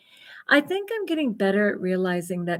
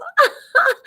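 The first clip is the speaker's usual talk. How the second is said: burst of laughter; 9 dB louder than usual. Real, not acted. Laughing at own empty audience.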